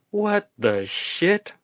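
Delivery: very high, slow, stretched-out shock; stunned outburst as both men drop dead; shocked exclamation. Acted. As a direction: let it rip in disbelief. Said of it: "I exclaimed".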